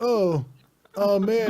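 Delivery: high, loud hammed-up whine; mock a wooden voice actor; bad voice acting. Imitating the game's hero actor, high hammed-up whine. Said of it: "he delivers it like all his lines".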